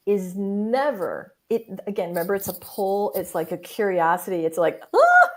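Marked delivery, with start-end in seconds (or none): 4.9-5.3 sudden high thrilled gasp; excited gasp. Retold or imitated. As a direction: gasp like the first drop of a ride. Thrilled gasp of the soul's pull.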